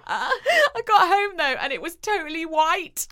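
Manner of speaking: can barely get it out for laughing. Real, not acted. Telling her friend the ending, laughing hard and high.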